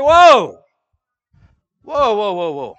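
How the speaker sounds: loud high whoa calls easing down; a sudden high shout that softens into calm whoas; shouting whoa, fading. Retold or imitated. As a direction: break up a fight, then settle it. Breaking up two pastors' fight.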